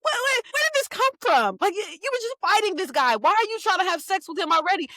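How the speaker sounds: high-pitched, outraged disbelief; shocked disbelief. Retold or imitated. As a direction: squeal it, like you can't believe what you read. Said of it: "I was like".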